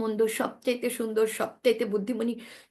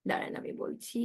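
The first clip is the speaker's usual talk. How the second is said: whisper; 10 dB quieter than usual. Real, not acted. Teacher stops reader, half aside.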